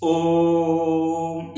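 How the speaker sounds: ओम held three beats; pluta om. Shown as a demonstration. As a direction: draw ओम out long. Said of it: "अब ये हो गया". (तीन मात्रा वाला लंबा ओम)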